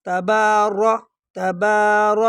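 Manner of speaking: تبار with a long "aa", then a heavy "ro"; thick ra. Shown as a demonstration. Teacher drilling the heavy letter, thick ra in تبار. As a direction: slowly drill تبار, landing firmly on a full r. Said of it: "یہ را کو موٹا پڑھا جائے گا".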